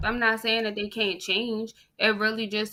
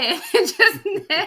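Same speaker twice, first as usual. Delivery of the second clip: laughing; 10 semitones higher, 5 dB louder than usual. Real, not acted. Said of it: "laughs heard".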